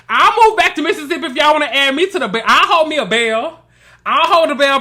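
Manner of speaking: high, loud, animated plea; excited loud pitch. Real, not acted. Pitching producers to add the speaker to the cast, loud and excited. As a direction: call out loudly to be picked.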